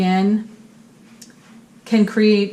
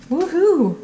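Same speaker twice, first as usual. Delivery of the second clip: excited cheer; 9 semitones higher than usual. Real, not acted. Cheering after good reading.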